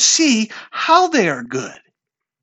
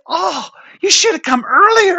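higher-pitched mock complaint; acted reproach; 9 semitones higher, 4 dB louder than usual. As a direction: voice an imagined, exasperated reproach. Voicing the sisters' reproach.

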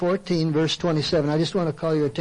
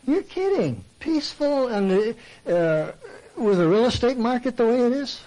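high, scoffing "you're kidding" as an imagined listener; mock disbelief; 6 semitones higher than usual. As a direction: play a doubting churchgoer, pitched up. Preacher voicing incredulous congregant.

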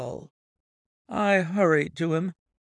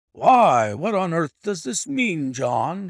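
stammering; 6 dB louder, slower than usual. Acted. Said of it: "he stammered".

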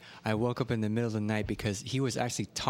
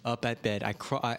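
ordinary talk

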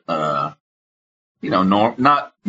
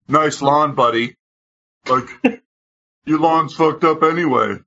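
higher, sneering imitation of the driver; mocking hipster; 6 semitones higher than usual. The driver taunting the homeowner, a higher sneering mock voice. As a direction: play the smug guy taunting back. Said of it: "he's like".

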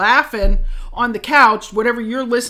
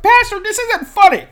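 much higher, louder offended protest; mock indignant; 12 semitones higher, 8 dB louder than usual. Scolding partner laughing on the couch, high mock outrage. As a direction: squeal it out, playfully offended. 'cause I was like.

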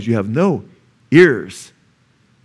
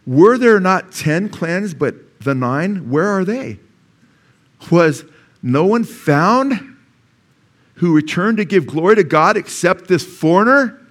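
higher, slow and loud, pleading; preaching Jesus' words; 6 semitones higher, 3 dB louder, slower than usual. Acted. Preacher voicing Jesus asking where the nine are, slow and pleading. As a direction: lift each question slowly. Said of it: "Jesus answered and said".

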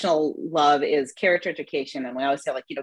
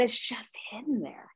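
soft unvoiced whisper; 3 semitones higher, 8 dB quieter than usual. Real, not acted. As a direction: breathe it as a secret.

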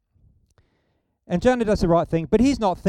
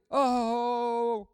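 angry Jonah; 5 semitones higher, 3 dB quieter than usual. Retold or imitated. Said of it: "really angry".

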